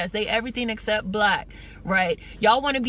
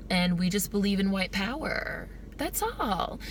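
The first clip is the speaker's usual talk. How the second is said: mock-nice bigot. Skit's Klan member insisting it's not personal, sweetly polite. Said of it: "saying it in a quote unquote nice way".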